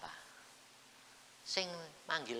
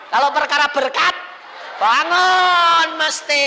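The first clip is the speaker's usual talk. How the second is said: preaching shout; 14 semitones higher, 20 dB louder than usual. Real, not acted. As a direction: stretch it out and blast it.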